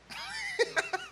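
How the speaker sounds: a high burst of laughter. Real, not acted. Preacher laughing at his own motorbike story, high.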